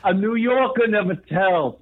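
slow, thick Brooklyn accent. Shown as a demonstration. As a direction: lay the Brooklyn accent on, drawn out. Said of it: "I can say it with more of a Brooklyn accent".

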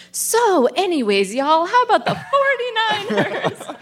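high, slow, chirpy driver cutting in, laughing through it; breezily change the subject as the driver; mock Uber driver barging in on a fight; oblivious Uber driver. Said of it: "And the Uber driver's like".

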